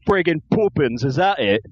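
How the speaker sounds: exaggerated bad Cockney-style British accent; mock British accent. Co-host mocking film Brits, put-on British accent. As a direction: ham up a stage British accent.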